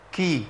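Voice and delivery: كي with air escaping after the k; breathy k demo. Shown as a demonstration. Teacher demonstrating the k with a kasra. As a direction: aspirate the k of كي. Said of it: "الحالة اللي بعد كده".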